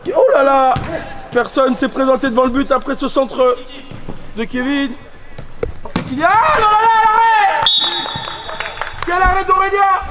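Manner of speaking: high-pitched excited call of a save; excited sports commentary. Real, not acted. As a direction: shout it out, thrilled. Commentator reacts to a big save.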